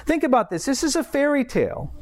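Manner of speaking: ordinary talk, nothing marked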